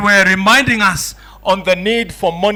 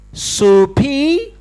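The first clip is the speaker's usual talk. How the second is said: Soupy pitched higher and louder with a rising question; mock pidgin repeated louder; 6 semitones higher than usual. Repeating to the Chinese delegate, Soupy asked higher and louder. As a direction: push Soupy up, hammed-up and insistent. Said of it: "He says to the Chinese delegate".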